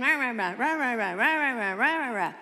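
sarcastic blah-blah chant; mock rah rah. A cynic waving off Jesus with a dismissive rah rah chant. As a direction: rattle it off like empty chatter. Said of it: "that cynical spirit is the same".

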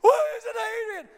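loud, high mock sobbing shout; Rocky. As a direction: bawl the question, hammed-up. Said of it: "He's crying".

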